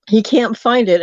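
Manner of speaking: ordinary talk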